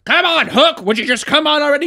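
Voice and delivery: high, loud, impatient plea; pleading. Retold or imitated. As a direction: whine at him to hurry up. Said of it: "They're just like".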